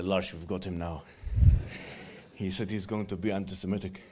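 low, quiet attempt at a Norwegian accent; half-hearted Norwegian accent muttered low. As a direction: mumble it in a mock Norwegian accent. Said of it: "he's got this kind of accent".

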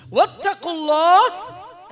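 loud, high ringing recitation; forceful recitation. Acted. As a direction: proclaim the verse with force.

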